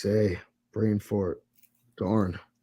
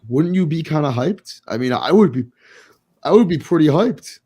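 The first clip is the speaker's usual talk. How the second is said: excited; 6 semitones higher, 8 dB louder than usual. Real, not acted. Hyped about Lamar trade.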